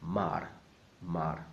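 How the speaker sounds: mar with the final r clearly pronounced; sounded R. Shown as a demonstration. Teacher drilling the first part, mar with a clear r. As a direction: say mar the Spanish way, r audible. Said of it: "R in the middle must sound".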